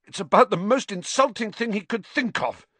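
high, strained outburst; gasping. A narrator's reading. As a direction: choke out the indignation between heaves. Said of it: "gasped Ron".

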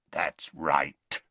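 lightly rasped, low werewolf voice. Acted. Greyback to Dumbledore, a low rasp. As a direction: rasp it with menace. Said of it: "rasped the other".

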